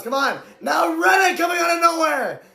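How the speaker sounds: usual speaking voice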